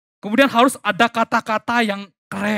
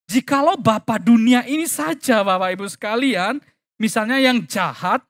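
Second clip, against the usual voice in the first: ordinary delivery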